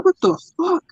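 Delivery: high, startled curse; shocked outburst. Retold or imitated. Father watching his son run off, startled. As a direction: blurt it out in disbelief. Son runs across lawn.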